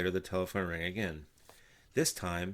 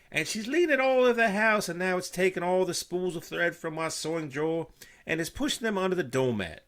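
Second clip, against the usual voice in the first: high, loud, fussy housewife rant; exasperated Martha; 10 semitones higher, 11 dB louder than usual. A narrator's reading. Wife ranting down the phone about the crow, high and loud. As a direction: pour out her complaints at full tilt.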